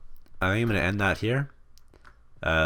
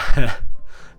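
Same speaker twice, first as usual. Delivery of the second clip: burst of laughter; 7 semitones higher, 15 dB louder than usual. Real, not acted. Laughs at taking Hammy's stuff.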